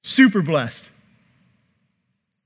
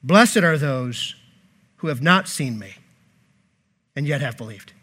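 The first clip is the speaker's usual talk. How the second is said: solemn blessing; 5 semitones lower, 4 dB quieter than usual. Retold or imitated. Preacher voicing Jesus.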